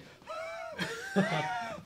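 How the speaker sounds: high shrieking laugh. Real, not acted. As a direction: burst out laughing. Laughing at Mace Windu joke.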